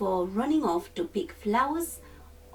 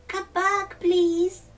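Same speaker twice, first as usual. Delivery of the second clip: much higher and louder pleading call; calling after them; 10 semitones higher, 7 dB louder, slower than usual. A narrator's reading. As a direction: cry out after friends running away. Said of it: "the Velveteen Rabbit called after them".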